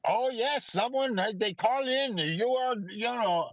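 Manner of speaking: put-on high Indian-accented clerk; hotel clerk insisting a guest smoked, high Indian accent. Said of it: "do an Indian accent 'cause that's my favorite".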